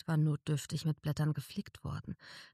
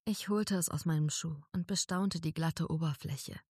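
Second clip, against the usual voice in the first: ordinary delivery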